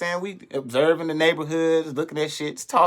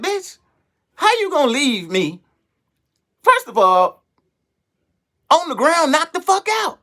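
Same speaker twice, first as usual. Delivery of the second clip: outraged scolding; 11 semitones higher than usual. Retold or imitated. Teller to the girl who left him, high indignant scolding. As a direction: squeal the outrage at her.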